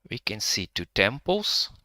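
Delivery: usual speaking voice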